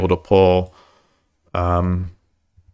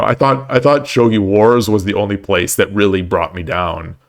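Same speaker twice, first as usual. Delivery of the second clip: ordinary talk, nothing marked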